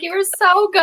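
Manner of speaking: high-pitched adoring gush; gushing praise. Retold or imitated. Reader caressing a beloved book, gushing.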